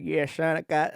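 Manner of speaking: a brief stab at a Louisiana dad; attempted Southern dad. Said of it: "I don't know how Archie Manning talks".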